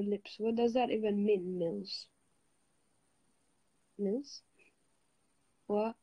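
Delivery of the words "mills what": ordinary talk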